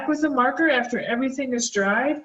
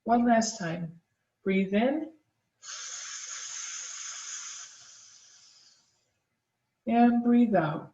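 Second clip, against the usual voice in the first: guided breathing; 4 dB quieter than usual. Acted. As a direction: calmly lead the breathing exercise. Leads a snake breath mindfulness exercise.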